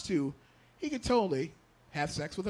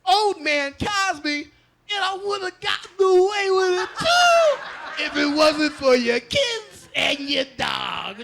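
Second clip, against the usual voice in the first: slow, loud, higher cartoon villain rant; unmasked Scooby-Doo villain; 8 semitones higher, 9 dB louder, much slower than usual. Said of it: "Scooby-Doo when they unmasked the villain".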